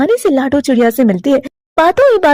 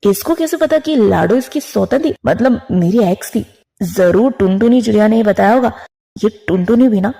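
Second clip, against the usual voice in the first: lower, muttered inner worry; worried husband's thoughts; 6 semitones lower than usual. Acted. Kalu fretting inwardly about his sister, lower voice. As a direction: grumble it to yourself.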